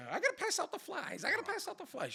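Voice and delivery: very high mock nagging voice; chirping girl. Girl fussing about her flyers, high mock chirping. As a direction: squeak it insistently, imitating her. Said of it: "The whole time chirping away".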